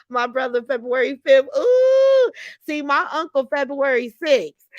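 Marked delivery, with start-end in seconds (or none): 1.6-2.3 excited ooh. Real, not acted. Reacting to uncle's birthday.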